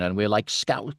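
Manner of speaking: usual speaking voice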